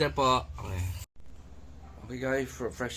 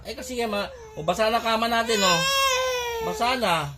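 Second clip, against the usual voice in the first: alarmed fuss; 6 semitones higher, 9 dB louder than usual. Real, not acted. Consoling crying child, spilled water.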